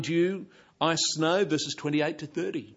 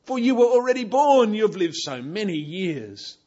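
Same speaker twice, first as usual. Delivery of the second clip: mocking sarcasm; 6 semitones higher, 9 dB louder than usual. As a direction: needle him with a mock-impressed rise. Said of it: "sense the rebuke and sarcasm in verse twenty-one".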